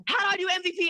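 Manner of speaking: high, put-on questioning voice; pestering friend impression. Mimicking her recruit's endless questions, high pestering voice. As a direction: pipe up with an eager question, pitched high. Imitates Brooke asking yet another question.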